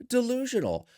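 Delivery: high, mocking one-word jab; sarcastic scoff. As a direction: scoff at the idea. Sarcastic mock of the clip.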